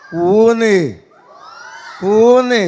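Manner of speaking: loud hoot to show how; hooting call. Shown as a demonstration. Performer showing the crowd how to hoot, loud. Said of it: "ഒന്ന് കൂവിയേ".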